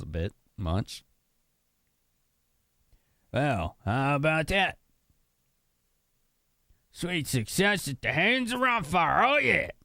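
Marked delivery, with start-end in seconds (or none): 3.3-9.7 high put-on character voice, rising at the end; Ronfar's triumphant boast. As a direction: crow over a success in a high hero voice. Reads game character's boast.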